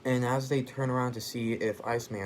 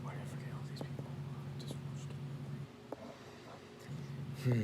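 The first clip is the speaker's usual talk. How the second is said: whispered grumble to self; very soft, hurried whisper under the breath; muttered aside; 3 semitones higher, 9 dB quieter, much faster than usual. Real, not acted. Storyteller grumbling to self over forgotten names, whispered aside.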